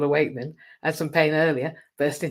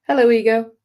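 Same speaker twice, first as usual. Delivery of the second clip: mock greeting; 7 semitones higher, 11 dB louder than usual.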